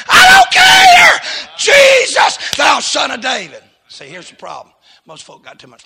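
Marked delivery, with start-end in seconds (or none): 0.1-3.6 very high, drawn-out yell; defiant crying out. Acted. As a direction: scream past those hushing you. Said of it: "He cried out the more".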